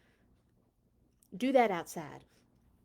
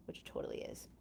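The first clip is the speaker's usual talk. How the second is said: whispered, much lower aside; 12 dB quieter, much faster than usual. Real, not acted. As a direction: let them in on a secret. Playful aside to the kids.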